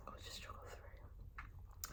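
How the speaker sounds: slow, unvoiced whisper. Shown as a demonstration. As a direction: breathe it out as a whisper. Repeats line whispered.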